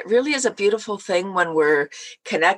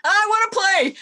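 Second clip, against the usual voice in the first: eager high reply; 13 semitones higher than usual. Real, not acted.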